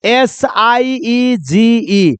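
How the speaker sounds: slow deliberate spelling. Shown as a demonstration. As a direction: spell S I E जी E letter by letter, very slowly. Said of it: "word का spelling देख लो".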